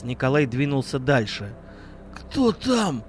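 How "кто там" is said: drowsy just-woken question; sleepy voice. Acted. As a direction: ask half asleep. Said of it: "сонным голосом спросил Иван".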